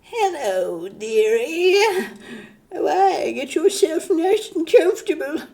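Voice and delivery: put-on high, quavering creepy old grandpa voice, leering. Hammed-up creepy grandpa offering dinner to a girl, high and leering. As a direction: play a lecherous old grandpa cooing at a girl. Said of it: "or we can go like".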